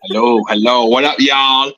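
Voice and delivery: bad American accent, high and bright; hammy fake American greeting. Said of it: "really bad imitation of some American".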